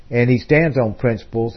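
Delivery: ordinary delivery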